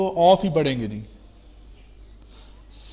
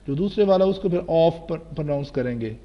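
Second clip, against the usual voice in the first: normal talking voice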